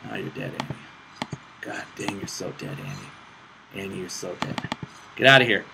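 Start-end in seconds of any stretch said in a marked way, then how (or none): 5.2-5.7 louder frustrated get out of here; loud yell. Real, not acted. Gamer yelling at an enemy champion. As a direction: shout at the enemy. Fighting opponent.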